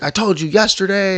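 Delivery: imitating mad coworker. Coworker scolding him for being late, imitated mad. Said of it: "kinda in my face mad about it".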